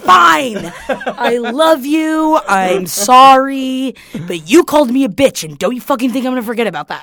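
forgive him but still furious; exasperated outburst. Retold or imitated. Retelling her fight with her brother, a loud laughing rant. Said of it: "And I'm like".

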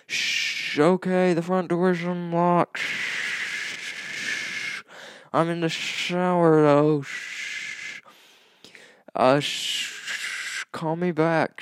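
shouting from the shower over the water; from another room. Shown as a demonstration. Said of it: "let me demonstrate what it would sound like".

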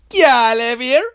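Jamaican character voice. Acted. Third contestant, Jamaican character.

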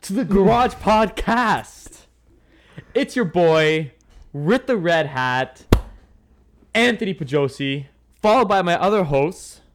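loud, high show announcer; podcast host intro. Acted.

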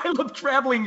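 high, laughing parrot of his words; mocking echo. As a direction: throw his words back at him, amused. Mocking partner's answer about Mars.

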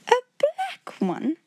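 very high, drawn-out timid question; flustered stammer. A narrator's reading. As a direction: echo it slowly in nervous dismay. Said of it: "stammered again".

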